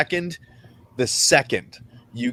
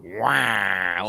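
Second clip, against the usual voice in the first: low, drawn-out mock quack; long low honking "waaah"; imitated bassy duck fart; 5 semitones lower than usual. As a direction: imitate a big angry duck, deep and long. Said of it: "when it's got bass, it's like".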